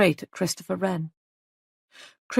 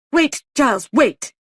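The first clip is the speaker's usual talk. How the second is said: urgent cutting in; 6 semitones higher, 7 dB louder than usual. A narrator's reading. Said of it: "Molly broke in".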